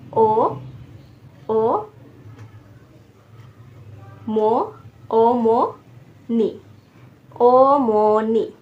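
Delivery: ओ, मो, नी dictated slowly with pauses; syllable by syllable. Shown as a demonstration. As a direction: hold each of ओ, मो, नी as its own syllable. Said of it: "तीन syllable बना लेने हैं".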